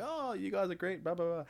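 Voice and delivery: high-pitched imitation of friendly locals; mock gushing locals. Belfast pub crowd relieved to meet Australians, mock gushing. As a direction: pitch it up and gush in mimicry. Retelling loyalists warming to Australians.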